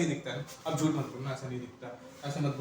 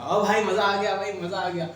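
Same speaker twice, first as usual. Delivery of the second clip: delighted exclamation; 4 semitones higher, 6 dB louder than usual. Real, not acted. Teacher delighted at working circuit.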